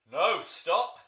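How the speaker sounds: loud, high, alarmed cry; gasped plea. Acted. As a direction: cry out to stop the fight. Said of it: "gasped Monty".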